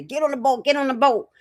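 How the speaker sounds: high-pitched, insistent imitation of urging; urgent mock call. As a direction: repeat it as a pressing retold order, pitch up. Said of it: "Something happened to make them say".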